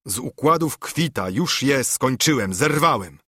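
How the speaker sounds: ordinary delivery, nothing marked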